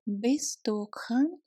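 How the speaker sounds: ordinary talk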